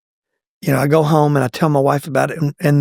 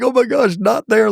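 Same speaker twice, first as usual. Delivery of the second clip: high, laughing panic; amused dread at the front row; laughing dismay; 7 semitones higher than usual. Retold or imitated. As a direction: squeak out a mock horrified "not there".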